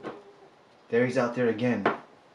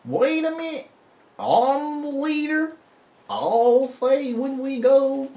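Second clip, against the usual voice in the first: much higher, slow, loud cartoon hound voice; cartoon hound dog; 7 dB louder, slower than usual. Imitating the Aristocats hound dog, high slow country drawl. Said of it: "he was like".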